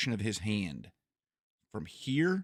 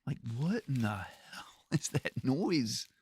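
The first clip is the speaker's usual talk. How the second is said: slow, hushed whisper with a laugh; whispered puzzlement; 5 dB quieter, slower than usual. Retold or imitated. Streamer recalling his confused thought, whispered and slow. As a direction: breathe it out, baffled.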